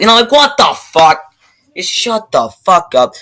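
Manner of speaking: incredulous rant outburst; exasperated outburst. Real, not acted. Ranting to viewers about clunky movie dialogue, exasperated outburst.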